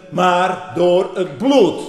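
bloed sung out slow and loud; drawn-out emphasis. Real, not acted. Preacher drives home salvation by blood, slow intoned stress. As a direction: lean long on bloed.

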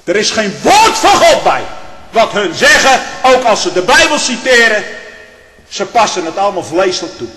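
forceful preacher's condemnation; full-force preaching. Real, not acted. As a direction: thunder it from the pulpit, easing at the end.

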